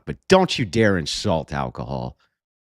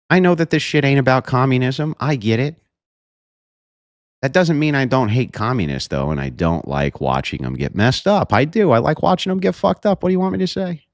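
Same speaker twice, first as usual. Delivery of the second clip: defiant rant; 7 semitones higher than usual. Real, not acted. Streamer defiantly admitting he hates communists, raised voice. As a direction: push back hard, no apology.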